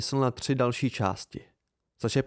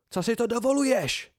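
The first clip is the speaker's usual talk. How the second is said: high-pitched indignant outcry; outraged cry; 11 semitones higher than usual. A narrator's reading. Devi held motionless by the narrator, outraged cry. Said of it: "vykřikla".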